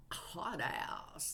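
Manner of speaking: unvoiced whispered aside. Real, not acted. Reader whispers to viewers after the outburst, whispered aside. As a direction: drop to a whisper.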